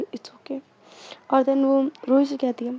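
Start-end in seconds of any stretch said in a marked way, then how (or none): 0.0-0.6 breathy whisper, barely audible; hushed reassurance. Retold or imitated. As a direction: mouth it softly to reassure. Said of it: "बोलती है इशारों में".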